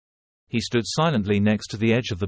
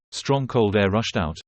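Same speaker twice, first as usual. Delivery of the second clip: plain reading voice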